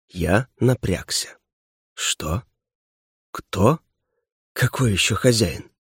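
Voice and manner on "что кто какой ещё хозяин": soft, dazed questions; bewildered. A narrator's reading. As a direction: ask softly, as if only half awake. Dazed hero questions unknown voice. (растерянно, тихо)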